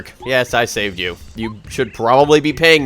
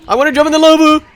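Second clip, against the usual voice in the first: eager shout; 6 dB louder, faster than usual. Pretend. Voicing a character's eager wish.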